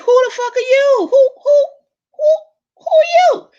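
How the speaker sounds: high, loud squealing disbelief with a laugh; hammed-up horror at a face without makeup; shocked shriek. Mock shock at bare face.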